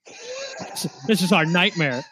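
mock shocked cop. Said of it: "They'd have been like".

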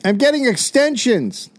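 high, slow mock holler; shouting over noise. Man announcing hair extensions over the crowd, shouting over noise. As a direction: stretch it out to carry over the din.

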